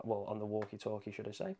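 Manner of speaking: ordinary talk, nothing marked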